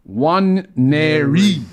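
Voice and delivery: "Nwaneri" very slow, long "Nwa"; slow careful name. Shown as a demonstration. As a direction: draw out "Nwaneri" deliberately. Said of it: "careful name in lineup".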